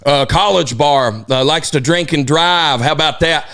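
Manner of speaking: slow Southern prosecutor voice; Southern drawl. Retold or imitated. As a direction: drawl it like a smug Southern lawyer. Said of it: "had, like, a foghorn leghorn".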